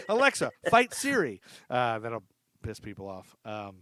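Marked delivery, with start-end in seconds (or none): 0.1-1.4 higher, louder joking order to a smart speaker; mock command. Host pranking listeners' speakers, mock loud command. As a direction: call it out like a prank command to the device.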